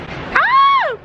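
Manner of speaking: sudden high yelp; surprised shriek. Real, not acted. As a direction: shriek as the snow hits. Caught in sudden heavy snow.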